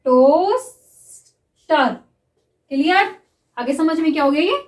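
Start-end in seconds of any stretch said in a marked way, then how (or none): 0.1-1.9 drawn-out word, spoken while writing it; slow dictation. Shown as a demonstration. Shorthand teacher dictates a word to students, drawn out. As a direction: stretch it slowly for the class to write. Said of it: "देखिए".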